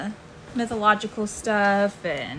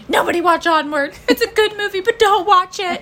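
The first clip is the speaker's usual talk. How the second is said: mock warning; 10 semitones higher, 8 dB louder than usual. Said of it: "and I was like".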